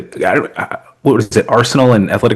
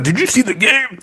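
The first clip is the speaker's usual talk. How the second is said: imitated eager fan; 12 semitones higher, 4 dB quieter than usual. Co-host imitates the Bayern fan downstairs, high excited voice. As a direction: mimic a neighbor bursting to talk about it. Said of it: "He's gonna be like".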